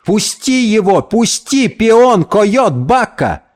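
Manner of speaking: high-pitched frantic demand; urgent outcry. A narrator's reading. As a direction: cry out to make him let go. Друзья оттаскивают быка от товарища. (отчаянный высокий выкрик)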